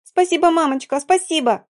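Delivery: put-on high delighted child squeal; squealing little girl. A narrator's reading. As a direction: thank mum like a thrilled child. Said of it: "завизжала так".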